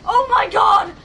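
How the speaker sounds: high, loud, panicked shrieks; scared screaming. Real, not acted. Player swamped by so many enemies, real scared screaming. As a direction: shriek it out, overwhelmed by fear. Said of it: "I'm scared".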